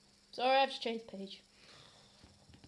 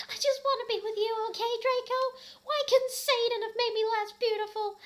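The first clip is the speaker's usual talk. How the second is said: high, loud whining shriek; hammed-up angry shout; 12 semitones higher, 11 dB louder than usual. Ebony shouting at Draco about her admirers, high shriek. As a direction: wail it like a spoiled teen. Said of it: "I shouted angrily".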